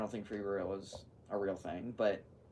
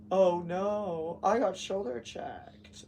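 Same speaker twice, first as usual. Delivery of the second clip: high, slow mock-dramatic whine; mock a fake victim, drawn out; sarcastic; 9 semitones higher, 5 dB louder, much slower than usual.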